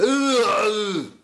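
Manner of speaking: high, loud "ugh" to find a voice; drawn-out strained groans before an accent attempt; exaggerated high groaning noise; loud warm-up groan. Shown as a demonstration. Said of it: "I have to go".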